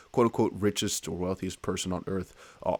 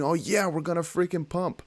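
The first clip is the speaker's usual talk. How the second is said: high, excited imitation of hype; imitating a crowd of hype traders; hyped-up quote; 8 semitones higher than usual. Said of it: "people start to fomo in thinking".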